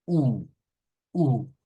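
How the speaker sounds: "U" rounded lips, a clipped front ü; German ü sound. Shown as a demonstration. Teacher isolating the ü vowel for students, demonstrating. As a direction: say "U" as the German umlaut ü, pushed forward. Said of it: "Es un sonido U".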